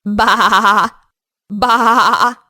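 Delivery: bleating baa baa; cartoon sheep bleating. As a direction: bleat like a little lamb. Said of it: "the animal that makes the sound".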